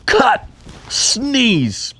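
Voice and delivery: diva's command. Retold or imitated. Betty halting the shoot so the sound girl can sneeze, high and grand. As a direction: play the imperious film star stopping the take.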